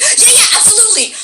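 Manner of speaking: high-pitched, eager imitation of a yes; eager agreement. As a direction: play her bubbly, over-willing agreement. Retold eager yes to early time.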